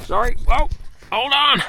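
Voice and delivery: sudden loud startled outburst. Real, not acted. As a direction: jump and blurt it out loud.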